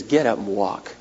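very low, hushed, understated miracle; quiet command. Retold or imitated. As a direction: say it softly to the one lying there. Jesus raises the dead, retold.

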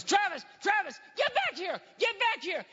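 high, urgent acted yell. Boy to his puppy running at a truck, acted yell. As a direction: call a runaway dog back at high pitch. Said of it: "and I yell".

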